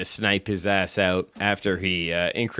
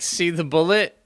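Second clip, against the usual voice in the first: imitating him; 9 semitones higher than usual. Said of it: "He's like".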